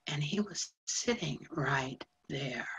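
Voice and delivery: whispered and slow; hushed whisper. Acted. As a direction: breathe it out, awed. Hushed recollection.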